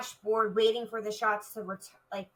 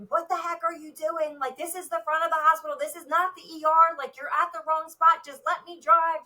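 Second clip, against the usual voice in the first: much higher, louder mock yell; retold screaming; 7 semitones higher, 4 dB louder than usual. Officer yelling at driver who went to wrong entrance, loud high yell. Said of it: "remember screaming".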